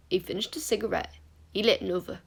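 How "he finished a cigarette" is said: plain reading voice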